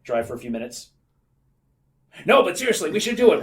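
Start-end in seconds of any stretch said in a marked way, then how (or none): 2.2-3.4 arguing. Acted. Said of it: "They would argue".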